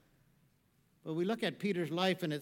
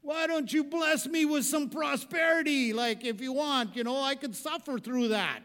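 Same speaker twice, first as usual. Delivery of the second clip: loud, high mock complaint to heaven; hammed-up Tevye bargaining with God; Tevye arguing with God; 7 semitones higher, 6 dB louder than usual. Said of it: "And he".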